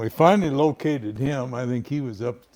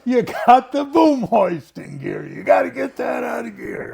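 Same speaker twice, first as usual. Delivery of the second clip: high, loud mock shout; hammed-up furious operator; imitating George shouting; 11 semitones higher, 9 dB louder than usual. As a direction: yell at the kid about the boom hoist.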